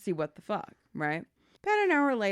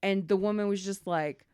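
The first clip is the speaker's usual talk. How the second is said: ordinary delivery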